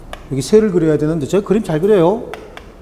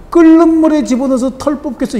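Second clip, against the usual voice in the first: higher, louder vicious threat; cruel threat; 9 semitones higher, 6 dB louder than usual. Acted. Angry woman threatening to pluck the bird, vicious. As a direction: spit out the cruel promise.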